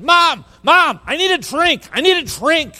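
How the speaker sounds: put-on high, loud child's cry for a drink; child yelling. Small son bursting in to his mom, high loud yelling. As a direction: shout it like a thirsty little boy bursting in.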